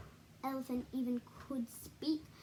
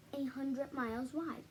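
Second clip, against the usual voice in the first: ordinary delivery, nothing marked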